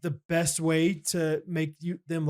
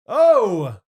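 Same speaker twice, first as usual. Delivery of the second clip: loud, high shocked shout; 6 semitones higher, 12 dB louder than usual. Real, not acted. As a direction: blurt it out in shock. Reacting to cougars joke.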